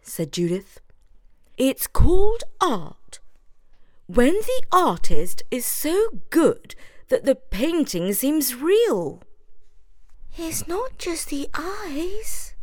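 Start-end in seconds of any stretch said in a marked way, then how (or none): 1.6-9.2 high, breathy know-it-all; Judith explaining. A narrator's reading. 10.4-12.6 slow, breathy frightened whisper; scared whisper. A narrator's reading. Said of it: "declared another little mouse".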